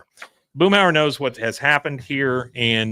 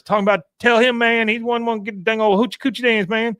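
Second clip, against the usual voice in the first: Boomhauer impression; 10 semitones higher, faster than usual. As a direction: slur it fast like Boomhauer. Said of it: "and he says".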